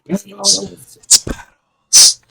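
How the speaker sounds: high, very slow creepy whisper; creepy ASMR whisper. Pretend. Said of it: "That's creepy".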